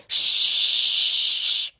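drawn-out hissed "shh"; long shush. Retold or imitated. Henry hushing the squeaking toys, long shush. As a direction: hush them with a long breath.

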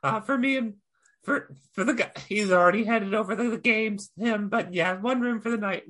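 high, hesitant goblin voice; high goblin voice addressing the barmaid; timid goblin. Acted. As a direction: creep up and ask nervously, pitched high. Goblin pays for the room.

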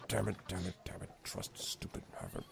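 very low, hushed grumbling; barely audible cursing to himself; muttering. Acted. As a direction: mutter curses under your breath. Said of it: "then he starts muttering".

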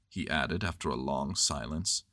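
plain reading voice

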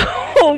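high loud laugh; burst of laughter. Real, not acted. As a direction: laugh out mid-sentence. Laughs mid protest.

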